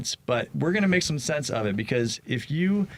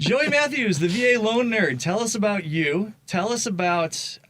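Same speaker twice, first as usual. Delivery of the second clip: excited; 10 semitones higher, 3 dB louder than usual. Real, not acted. Hyped host introduction.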